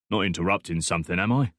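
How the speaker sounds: put-on quick thick Cockney accent, sly question; Cockney bloke sizing up the boy. A narrator's reading. Stranger in a cafe questioning Alex, thick Cockney accent. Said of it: "speaking in a thick Cockney accent".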